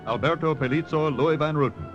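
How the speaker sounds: ordinary talk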